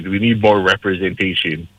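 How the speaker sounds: ordinary delivery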